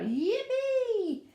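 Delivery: high, drawn-out cheer; whooping high yippee; gleeful cry. A narrator's reading. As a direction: cry out with storybook glee. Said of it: "cried out".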